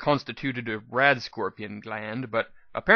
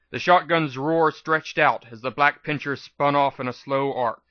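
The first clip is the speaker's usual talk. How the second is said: ordinary delivery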